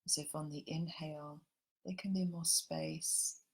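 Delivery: gentle meditation guide drawing everyone into the heart; guided meditation. Acted. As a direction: ease the listeners into calm, unhurried.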